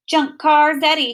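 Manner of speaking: mock the girl with a singsong jeer; teasing. A narrator's reading. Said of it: "he teases".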